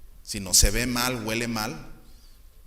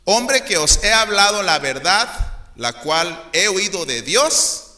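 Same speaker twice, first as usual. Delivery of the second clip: solemn scripture reading; 3 semitones higher, 5 dB louder than usual. Acted. Pastor reading Jesus's words to the congregation, solemn declaiming. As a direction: proclaim the verse with weight.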